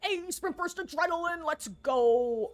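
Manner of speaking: high, pumped-up game hero call; hype character voice. Pretend. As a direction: cheer the perk on like a hyped hero. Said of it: "I'm not being".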